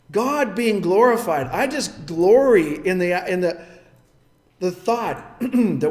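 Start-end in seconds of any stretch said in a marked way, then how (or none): none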